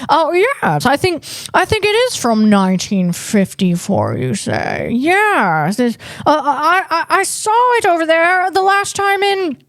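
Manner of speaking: a terrible old Hollywood star imitation; Jimmy Stewart. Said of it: "that's a terrible Jimmy Stewart impression".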